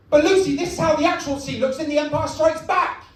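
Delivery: high, indignant acted protest; biting back. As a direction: defend yourself in hammed-up exasperation. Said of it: "And I bite back with".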